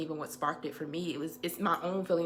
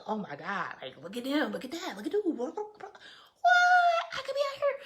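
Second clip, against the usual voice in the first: starts light, then rises into a laughing high shout; mock envious outburst; 10 semitones higher, 7 dB louder than usual. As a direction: laugh and shout at how far ahead everyone is. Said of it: "and I'm just like".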